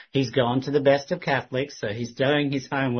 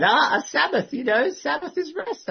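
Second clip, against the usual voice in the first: imitating him, much higher and louder; eager burst, pitched way up; excited; 4 dB louder than usual. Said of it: "he was getting excited".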